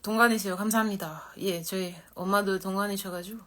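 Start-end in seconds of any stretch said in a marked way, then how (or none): none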